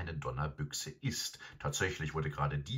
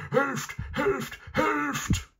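very high, loud howl for help; shrill yelping alarm from the hound; howling dog; 7 dB louder, much slower than usual. A narrator's reading. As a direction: cry out like a panicked talking dog. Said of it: "heulte Garm".

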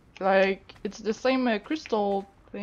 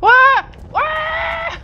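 startled scream; 11 dB louder than usual. Real, not acted. Attacked by a creature in game.

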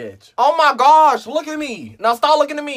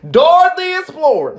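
high, loud guess; excited shout; 7 semitones higher, 4 dB louder than usual. Real, not acted. Guessing game player shouts the answer excitedly. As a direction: blurt the answer out triumphantly.

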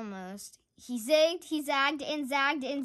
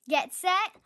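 louder rising call to roll; crowing call; 5 semitones higher, 5 dB louder, much slower than usual. Acted. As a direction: crow out the start like a race starter. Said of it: "crowed Rooster".

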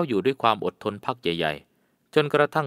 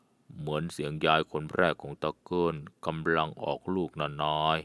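low, soft, slow murmur; 6 semitones lower, 7 dB quieter than usual. A narrator's reading. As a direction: murmur it to your master, barely aloud. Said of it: "พึมพำเบาๆว่า". (ตาเกิ้ลพึมพำกับนายเบาๆ ต่ำและช้า)

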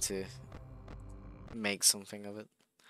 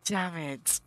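normal talking voice

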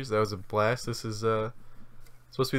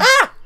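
startled scream; 16 dB louder than usual. Real, not acted. Crab startles player.

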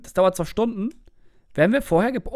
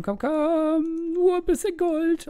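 high pleading; 9 semitones higher, much slower than usual. Real, not acted.

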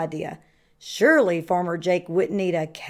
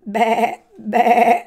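wavering sheep bleats; bleating; 5 dB louder than usual. A narrator's reading. Sheep spots Turkey in his pig costume, bleating. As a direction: bleat it like a sheep, second one louder. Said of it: "bleated Sheep".

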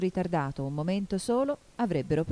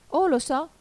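high, louder exclamation from Alice; 11 semitones higher, 6 dB louder than usual. A narrator's reading. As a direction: burst out with it, pitched up. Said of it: "esclamò Alice". (esclamazione acuta e vivace)

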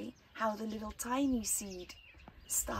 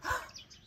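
gasp. Acted. Child breaks the plant.

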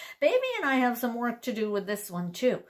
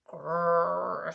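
bear growl; 6 semitones lower than usual. Acted. Storyteller voicing the bear for kids, growling. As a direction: lower rumbling growl. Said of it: "says the bear".

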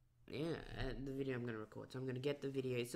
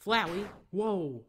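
startled shout; 5 semitones higher, 10 dB louder, much slower than usual. Real, not acted. Shocked at the character's return.

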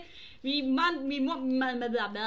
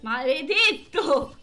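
exasperated outburst; 6 dB louder, much slower than usual. Real, not acted. Reacting to dying in game.

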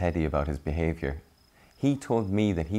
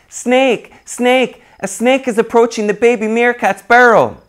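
high, much louder alarm shout; barking as loudly as possible; 14 dB louder than usual. Acted. Millie warning of a real snake, loudest high shout. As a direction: really yell it, full alarm. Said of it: "barked out as loudly as she could".

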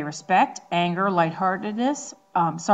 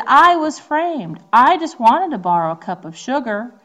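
wolf pleading innocence; 6 semitones higher, 7 dB louder than usual. Acted. Teacher voicing the wolf's defence, higher and louder. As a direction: insist you were framed. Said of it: "wolf said".

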